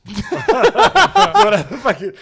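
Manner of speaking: laughing. Real, not acted.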